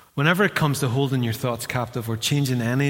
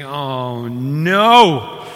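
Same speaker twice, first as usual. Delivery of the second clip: a drawn-out, much louder "no" of dread; dismayed groan; 6 dB louder than usual. Retold or imitated. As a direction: let the dread swell on no. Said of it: "you think".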